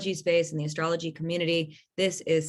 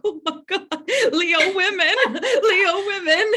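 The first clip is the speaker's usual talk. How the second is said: much higher and louder, laughing through it; gleeful laughing squeal; 13 semitones higher, 7 dB louder than usual. Retold or imitated. Host reliving her thrill at lionesses, laughing squeal. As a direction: squeal it out in delighted recognition. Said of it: "I was like".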